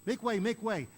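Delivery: loud call to clear the crowd. Retold or imitated. Jairus calling to the crowds to clear a path, loud call. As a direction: shout it to part the crowd.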